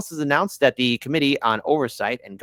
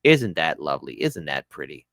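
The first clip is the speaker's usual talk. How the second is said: sarcastic; 11 semitones lower, 3 dB quieter than usual. Real, not acted. Mocking news of a cover-up.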